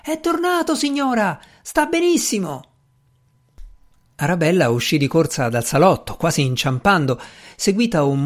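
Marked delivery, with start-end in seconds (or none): 0.0-2.7 voce acutissima, senza vero volume; grido di richiamo. A narrator's reading. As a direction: chiama verso l'interno della casa. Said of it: "si girò verso l'interno della casa e gridò". (richiamo gridato)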